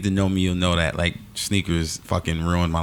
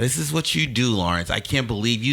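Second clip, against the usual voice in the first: mock disappointed mother; 7 semitones higher than usual. Keeps voicing the disappointed mother.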